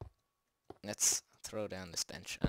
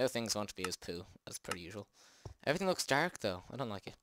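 ordinary talk

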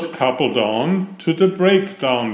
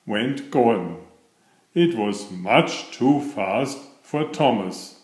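usual speaking voice

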